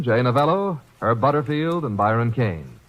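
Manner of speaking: normal talking voice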